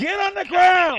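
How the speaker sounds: loud, slow barked command; shouted surrender demands. Real, not acted. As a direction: yell it at a suspect. Police arrest at gunpoint.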